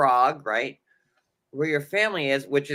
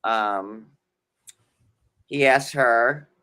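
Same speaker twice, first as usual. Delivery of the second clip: ordinary delivery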